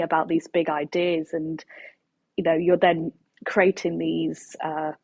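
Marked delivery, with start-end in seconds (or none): none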